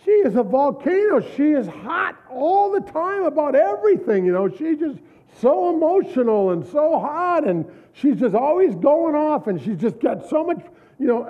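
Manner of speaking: husband complaining that his wife always goes off; exasperated husband. Retold or imitated. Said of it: "the guy said".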